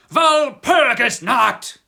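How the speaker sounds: desperate cry. Acted. Terrified coachman cries out the night's name to the traveller in desperation. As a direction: scream the name slowly in panic. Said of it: "in an agony of desperation, he cried".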